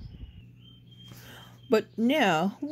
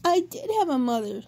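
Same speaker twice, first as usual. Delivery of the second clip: put-on baby bird voice; 7 semitones higher than usual. A narrator's reading. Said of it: "said the baby bird".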